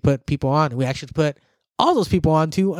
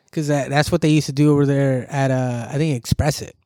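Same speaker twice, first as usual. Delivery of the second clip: usual speaking voice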